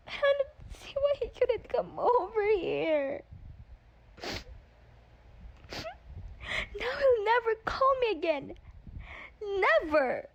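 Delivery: high, tearful wail; crying. Acted. Teen daughter cries about the boy she can't see. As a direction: sob it out, heartbroken. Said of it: "now she's bawling".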